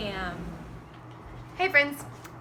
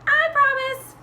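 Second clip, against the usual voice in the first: high, loud hen voice; promising chicken; 13 semitones higher, 9 dB louder than usual. Acted. Chicken answers Mister Gumpy, high loud promise. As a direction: squawk out an eager promise. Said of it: "Chicken said".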